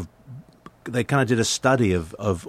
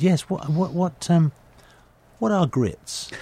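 higher, politely puzzled British voice; polite puzzled question; 9 semitones higher, slower than usual. Retold or imitated. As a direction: ask it like a baffled English tourist. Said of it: "and I said".

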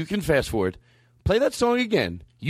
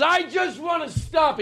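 much higher, slow strained shout; Eddie Pepitone; 14 semitones higher, slower than usual. Retold or imitated. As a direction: whine it out, overwhelmed. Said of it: "He goes".

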